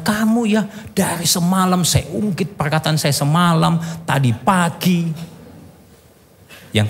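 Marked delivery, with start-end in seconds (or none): 0.0-5.1 high-pitched scolding, slowing on the last words; angry scolding. Retold or imitated. Pastor scolding his wife in the car, high angry reproach. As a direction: scold her for forgetting again. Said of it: "Saya marah".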